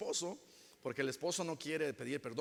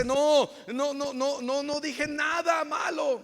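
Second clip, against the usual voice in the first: higher, shouted denial; mock loud protest; 9 semitones higher, 6 dB louder than usual. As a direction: protest innocence loudly. Accused person protests loudly. (protesta gritada y exagerada)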